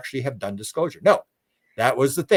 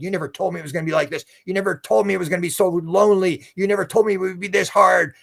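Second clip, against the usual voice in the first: higher-pitched mock protest; angry complaint; 6 semitones higher than usual. Man protesting to the beings, mock angry. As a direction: retell an indignant outburst, voice pitched up. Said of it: "then he started swearing at him".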